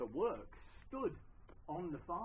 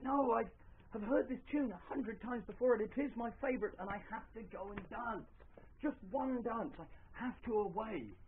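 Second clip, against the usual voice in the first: lightly acted, higher-pitched eagerness; mildly carried away with enthusiasm; eager insistence; 7 semitones higher, 3 dB louder than usual. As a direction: insist excitedly, voice lifted. Friend insists on leaving to dance.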